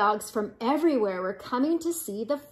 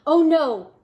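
loud, raised cry of dismay; 4 semitones higher, 8 dB louder than usual. Acted. Prairie dogs dread Big Bark.

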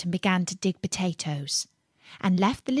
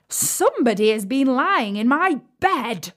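raised, emphatic gruff bear voice; rough gruff bear; 5 semitones higher, 4 dB louder than usual. A narrator's reading. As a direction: roar it roughly as the great huge bear. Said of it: "in his great rough, gruff voice".

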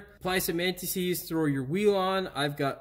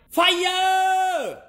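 a huge, high mock shout of Fire!; hammed-up yell of Fire!; dramatic shout; 8 dB louder than usual. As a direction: belt out Fire! like a silly alarm. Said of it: "That sounded stupid".

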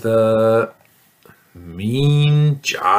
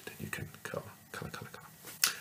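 soft voiceless whisper, faster at the end; 20 dB quieter, much faster than usual. Real, not acted. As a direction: hush the instructions. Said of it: "whispered instructions".

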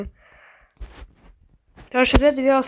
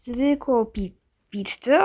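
ordinary delivery

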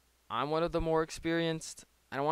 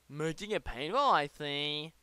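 lightly voiced mocking opponent; a hint of the rival's voice; impression of opponent. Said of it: "That was my impression of him".

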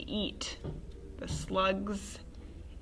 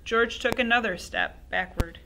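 ordinary delivery, nothing marked

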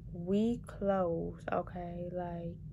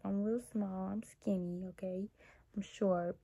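soft whispered confession; whispering; 5 dB quieter than usual. Real, not acted. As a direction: whisper it almost to yourself. Whispered about her size.

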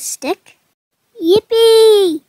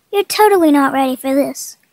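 ordinary talk, nothing marked

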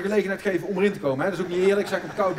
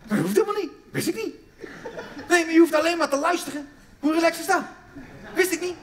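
higher and louder amazed delight; delighted discovery; 8 semitones higher, 4 dB louder than usual. Real, not acted. Comedian marvelling to the audience, higher and louder. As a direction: revel in the discovery.

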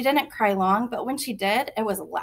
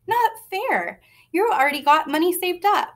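protesting whine; 8 semitones higher than usual. Acted. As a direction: whine that it's not fair. Brother protests the bet.

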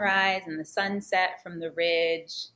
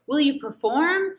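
eager student; 8 semitones higher, 6 dB louder than usual. Retold or imitated. Student asks teacher to perform.

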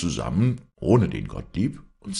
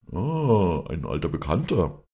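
calling out; 4 dB louder than usual. Acted. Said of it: "rief Gottlieb".

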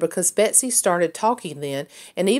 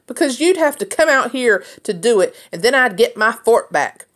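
shouting back; 5 semitones higher, 4 dB louder than usual. A narrator's reading. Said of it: "Erland shouted back".

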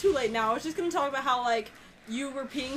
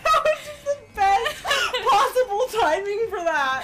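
loud, high, slow laughing; 7 semitones higher, 8 dB louder, slower than usual. Real, not acted. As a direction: barely get it out through laughter. Laughing hard at the burp.

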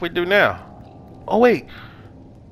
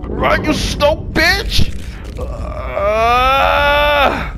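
very high, slow, loud yell; panicked scream; 11 semitones higher, 4 dB louder, much slower than usual. Real, not acted. Screaming while fleeing.

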